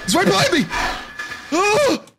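very high-pitched panic; panicked cry. Real, not acted. Player with it right behind them, panicked cry. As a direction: cry out as the pursuer closes in.

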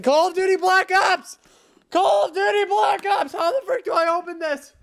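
very high, loud shouted title; excited shouting. Real, not acted. Excited fan shouting the game title at camera. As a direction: scream the name like a fanboy. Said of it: "I'm just so excited".

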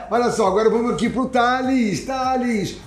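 high, drawn-out name call; calling up the performer. Real, not acted. Host bringing Thales on for his show, high announcing call. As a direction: announce the act with a lift.

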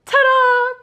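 high, loud showy reveal; excited ta-da. Real, not acted. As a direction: present it with a flourish. Reveal of mirror.

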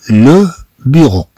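لو بيرو said slowly and clearly as a model; teacher's careful slow لو بيرو; slow model word. Shown as a demonstration. Teacher showing French learners a word, slowly. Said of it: "كما نلاحظ هنا عندنا".

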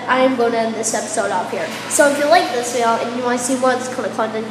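usual speaking voice

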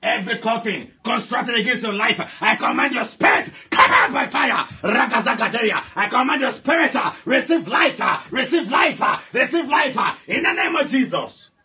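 loud, driving deliverance prayer; fiery preaching. Acted. Pastor commanding the congregation's family out of coffins, fiery preaching. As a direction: preach at full force, commanding.

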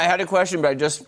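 talking with a mouth full; speaking through a bite of food. Real, not acted. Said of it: "Okay, you just took a bite".

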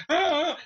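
high startled cartoon shriek; cartoon scream. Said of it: "jumps like a fucking cartoon".

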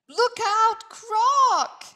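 high shouted warning; yelled warning. A narrator's reading. Animals warning each other, high yell. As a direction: yell it in alarm. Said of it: "yelled Dingo".

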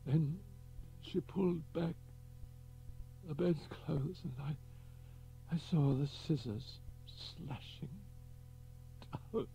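high, halting dying man, voice cracking; voice breaking. Acted. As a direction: let the voice break as he relives it. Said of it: "His voice broke".

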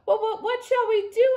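high, loud, stammering worry; panicked. A narrator's reading. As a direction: stumble over the question in panic. Boris panics, wolf on roof.

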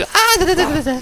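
hoch und lauter, hektisch wiederholt; aufgeregtes Gestammel. Real, not acted. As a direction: stammle vor Aufregung über die Waffe. Aufregung beim Waffenfund. (aufgeregt stammelnd beim Waffenfund)

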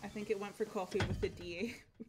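ordinary talk

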